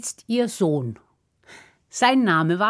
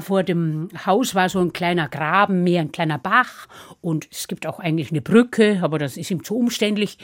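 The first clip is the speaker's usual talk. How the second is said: plain reading voice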